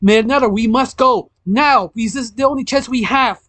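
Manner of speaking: high-pitched, pressing appeal; acted hurried urgency; urgent urging. A narrator's reading. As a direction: urge them on, speeding up. Himiko urges escape now.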